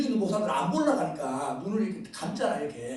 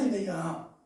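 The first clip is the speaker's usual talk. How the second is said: normal talking voice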